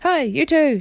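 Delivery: high, loud storybook shout. A narrator's reading. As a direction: call out to wake two sleepers. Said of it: "shouted Jill in a loud voice".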